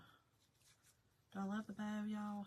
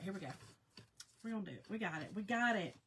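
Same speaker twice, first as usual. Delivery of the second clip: ordinary talk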